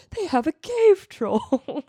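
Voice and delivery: dramatic Boromir. Retold or imitated. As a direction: drag it out like a grave warrior. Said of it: "Boromir's like".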